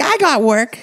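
very high imitated mom voice; protesting mom. Mom disputing dad's claim at the table, imitated high and insistent. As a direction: insist in a squeaky mock mom voice. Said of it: "She's like".

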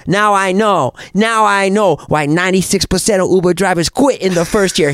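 irate Korean-accented cabbie impression. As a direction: put on a Korean accent and vent. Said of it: "I can't do the accent".

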